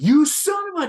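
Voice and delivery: high, loud imitation of a furious outburst; mock-enraged movie victim's shout; angry acted yell. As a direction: yell it high, cut off mid-curse. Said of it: "Adam's like".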